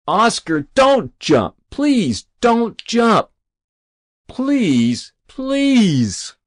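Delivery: high, desperate begging; agonized pleading. A narrator's reading. Rabbi on his knees to the boy, agonized pleading. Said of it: "then agonizingly back at Ozzy".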